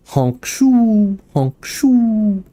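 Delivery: mock snore, honk then shoo; cartoon snoring in and out. Pretend snoring, then "I'm awake.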